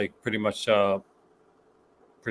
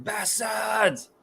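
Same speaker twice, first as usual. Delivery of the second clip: imitated scream; 10 semitones higher than usual. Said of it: "screaming the word".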